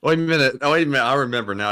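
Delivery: ordinary delivery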